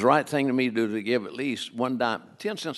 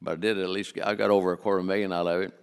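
ordinary talk, nothing marked

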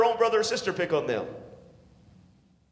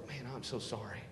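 soft, apologetic murmur; quiet sorry; 10 semitones lower, 15 dB quieter than usual. Retold or imitated. Youth worker owning the blame, said quietly. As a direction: quietly own the fault. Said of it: "He's gonna sit back and go".

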